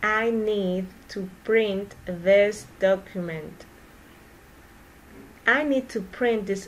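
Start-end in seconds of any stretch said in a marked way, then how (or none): 0.0-3.6 slow, careful, every word clear; slow model sentence for repeating. Shown as a demonstration. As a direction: say it slowly for learners to copy. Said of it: "teacher repeats sentence slowly for practice".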